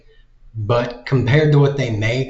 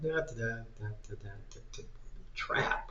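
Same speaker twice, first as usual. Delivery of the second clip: mumbled skimming; 20 dB quieter, faster than usual. Retold or imitated. Mocks someone skimming a contract.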